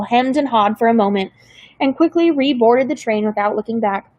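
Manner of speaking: usual speaking voice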